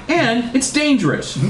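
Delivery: high, theatrical warning; hammed-up alarm; stern warning. A narrator's reading. Dad warning against starting over.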